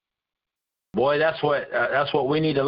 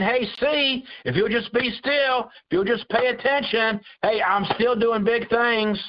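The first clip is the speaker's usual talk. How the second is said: much higher, emphatic call; much higher preaching God voice; God's voice; 8 semitones higher than usual. Retold or imitated. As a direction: urge them to be still and listen. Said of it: "God still is saying".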